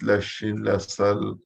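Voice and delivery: ordinary talk, nothing marked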